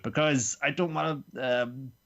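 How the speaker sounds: ordinary talk, nothing marked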